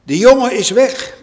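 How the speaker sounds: loud, high anguished cry; cried out. A narrator's reading. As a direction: call out in alarm. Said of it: "riep hij".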